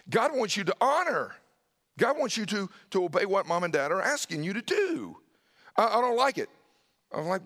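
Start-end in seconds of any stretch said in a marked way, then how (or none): 0.1-5.2 higher and louder, pressing hard; a dad's raised, pleading insistence; urgent raised plea. Retold or imitated. Dad urging son to obey. 5.8-6.5 put-on high, loud childish whine; a little boy refusing his dinner, high whine; whiny child protest. Son protesting in retold scene.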